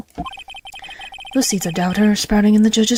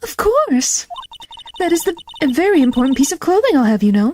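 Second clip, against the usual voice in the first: indignant game witness; 7 semitones higher than usual. Acted. Voices witness answering in game.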